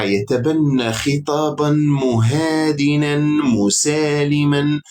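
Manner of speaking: slow, sarcastic drawn-out words; sarcastic drawl. Acted. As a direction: stretch each word out mockingly. Sarcastic about a conciliatory speech.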